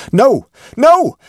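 panicked high-pitched scream of refusal; terrified scream. A narrator's reading. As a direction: shriek it out as if burned by a ghost's touch. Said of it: "it rose to a scream as it spoke".

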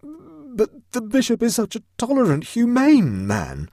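very high, then loud and slow; protesting Huddle. A narrator's reading. Huddle defending the bishop, high pleading protest. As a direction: plead it high, dragging out humane.

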